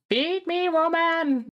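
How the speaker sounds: high, drawn-out playful demand; mock infant demand. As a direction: demand it like a bossy hungry baby. Infant demands food from mother.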